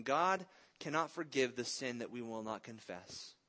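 ordinary delivery

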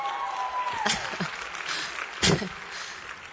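drawn-out giggly laughter; real laugh. Real, not acted. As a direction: laugh helplessly at the awkward start. Laughs with the welcoming audience.

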